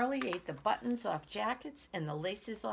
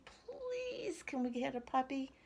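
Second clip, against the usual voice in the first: put-on whiny little-girl plea, pitched way up; begging child; 7 semitones higher than usual. A narrator's reading. Cassie asks her parents for a puppy, begging. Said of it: "begged Cassie".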